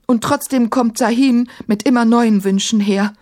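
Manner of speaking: high-pitched, aggrieved outpouring; distressed, insistent complaint; agitated lament. A narrator's reading. Caliph pours out his troubles.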